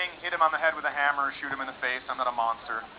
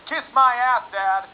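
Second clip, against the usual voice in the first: put-on higher, louder bratty teenager talking back; bratty teen snap; 7 semitones higher, 5 dB louder, slower than usual. Teenage son sneering back at his dad, bratty. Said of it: "with all his bitchy teenage angst".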